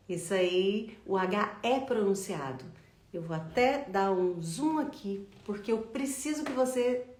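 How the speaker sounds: ordinary talk, nothing marked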